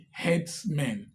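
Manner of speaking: ordinary delivery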